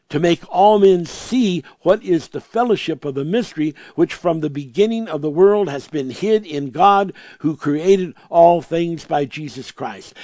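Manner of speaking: normal talking voice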